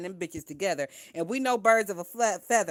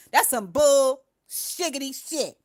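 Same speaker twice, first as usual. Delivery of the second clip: slower, silly stretched "shiggity"; playful drawn-out scoff; 4 semitones higher, 6 dB louder, slower than usual. Real, not acted. Streamer replays her own line as a slow silly joke. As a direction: savor "bull shiggity shit" slowly, mocking.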